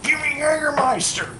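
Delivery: very high, loud mock demand; exhausted, irate. Irate woman at the bar ordering a drink, high mock yell. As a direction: imitate a worn-out, angry drinker yelling an order. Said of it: "like, exhausted. Just like, she had just been".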